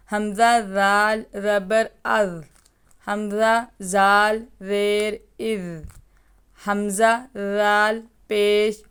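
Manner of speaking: teacher sounding ار with a thin ذال, no hiss; thin ذال drill. Shown as a demonstration. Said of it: "اس کو باریکی سے پڑھیں گے".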